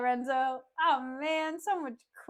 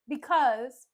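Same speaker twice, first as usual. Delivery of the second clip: ordinary delivery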